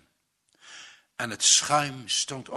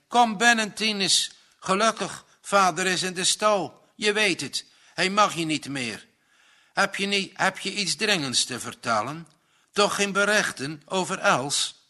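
put-on anxious young son; 6 semitones higher, 4 dB louder than usual. A narrator's reading. Gerrit voiced, anxious young son.

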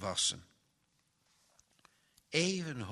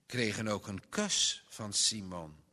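plain reading voice

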